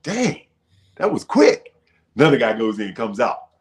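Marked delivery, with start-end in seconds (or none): 0.0-1.7 high, impressed surprise; surprised. Retold or imitated. Newcomer noticing singers leave quickly, surprised. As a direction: raise your brows at how fast it was. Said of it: "I was like".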